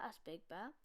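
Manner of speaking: usual speaking voice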